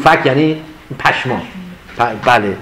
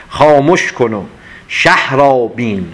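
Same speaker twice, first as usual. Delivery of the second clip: poem recitation; 4 semitones lower, slower than usual. Acted. Teacher reciting a verse on silence, slow and hushed.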